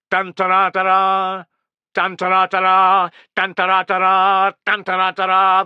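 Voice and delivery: loud sung-out trumpet fanfare; imitated trumpet call. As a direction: sound like Roman horns. Trumpets sounding the advance.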